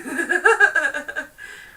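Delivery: bursting into a loud laugh; real laugh. Real, not acted. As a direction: genuine amused laughter. Laughing at the card's message.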